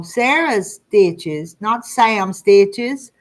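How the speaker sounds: Sarah said slowly, not Sam stressed; correcting the name. Shown as a demonstration. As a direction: stress on Sarah, slow and deliberate. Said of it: "I was reading that wrong".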